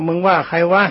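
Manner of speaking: an angry shouted question; retold shout. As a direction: bark it back as a monk. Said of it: "ท่านก็ตะโกนถามว่า".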